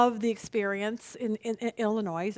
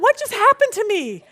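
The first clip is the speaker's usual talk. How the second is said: astonished; 9 semitones higher, 7 dB louder than usual. Retold or imitated. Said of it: "And I'm like".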